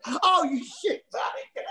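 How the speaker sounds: slow, high, laughing through words; cracking up at the prank. Real, not acted. Realising it was a prank.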